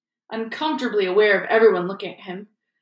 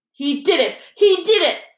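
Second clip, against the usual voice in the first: higher and higher, spat out; spat accusation; 9 semitones higher, 4 dB louder than usual. Acted. Filch accusing Harry before the teachers, spitting fury. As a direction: jab the words at the boy. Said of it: "Filch spat".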